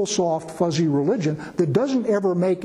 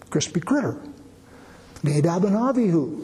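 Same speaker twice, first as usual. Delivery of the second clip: usual speaking voice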